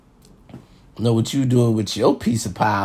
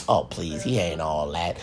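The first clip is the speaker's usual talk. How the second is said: low, soft dismissive hater; 9 semitones lower, 9 dB quieter than usual. Retold or imitated. Imitating haters who dismiss him, low and soft. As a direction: mutter it like a sneering doubter. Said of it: "listen to this and be like".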